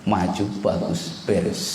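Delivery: ordinary talk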